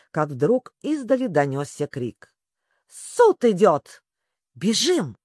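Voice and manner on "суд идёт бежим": louder, slow, urgent call; yelled alarm. A narrator's reading. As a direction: shout the alarm and urge to run. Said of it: "завопил Грифон".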